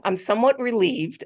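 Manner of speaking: normal talking voice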